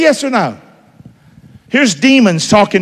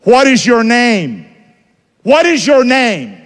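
slow, higher-pitched demand; commanding question; 6 semitones higher, slower than usual. Acted. Jesus questioning the demons, slow commanding question. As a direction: question it firmly, each word drawn out.

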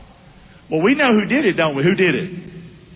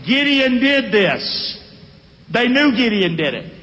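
usual speaking voice

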